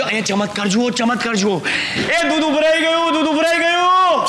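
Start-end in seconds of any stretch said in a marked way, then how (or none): 2.0-4.3 slow, higher-pitched drawn-out call; stretched sing-out holler. Acted. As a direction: raise the alarm to another room. Calls out that milk boils over.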